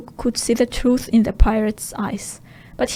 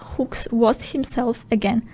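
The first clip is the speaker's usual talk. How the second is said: ordinary delivery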